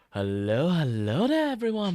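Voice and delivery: bright high-pitched streamer greeting; sing-song hello to the chat; cute anime streamer. Acted. Streamer greeting viewers on opening.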